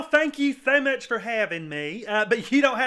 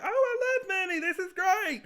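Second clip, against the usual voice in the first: excited; 8 semitones higher than usual. Retold or imitated. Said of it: "And I was like".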